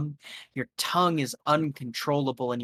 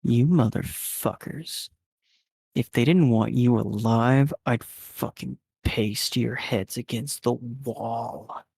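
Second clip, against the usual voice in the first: menacing guard; 3 semitones lower than usual. Acted. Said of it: "looks at you and says".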